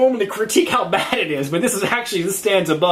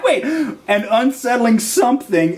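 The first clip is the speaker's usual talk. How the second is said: high laugh then slow, stunned reread; 3 semitones higher, much slower than usual. Real, not acted. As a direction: laugh it out, then read slowly.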